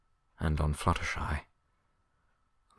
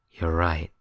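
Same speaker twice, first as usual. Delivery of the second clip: hollow voice; 3 dB louder than usual. Acted. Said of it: "The mare's voice was hollow".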